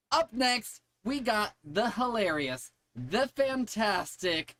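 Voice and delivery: high, drawn-out stage introduction; announcer. Real, not acted. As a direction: build up the next comic. Host announces next act.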